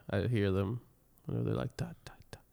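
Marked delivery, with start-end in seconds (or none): 1.8-2.4 whispered, unvoiced mimicking of footsteps; hushed imitation of steps overhead; imitated footsteps. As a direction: tap out the words in a faint whisper.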